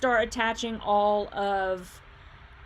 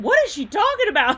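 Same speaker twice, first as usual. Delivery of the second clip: confused; 10 semitones higher, 8 dB louder, faster than usual. Retold or imitated. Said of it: "And I'm thinking".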